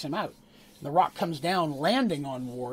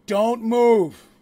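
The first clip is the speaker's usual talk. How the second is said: loud, raised command; forceful warning; 5 semitones higher, 10 dB louder than usual. Acted. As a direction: order a friend firmly to hold still. Hammer raised, friend holds the spike.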